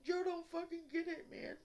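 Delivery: real upset pleading with viewers; emotional outburst. Real, not acted. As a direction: let it spill out, on the edge of tears. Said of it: "I'm sorry I'm getting so emotional".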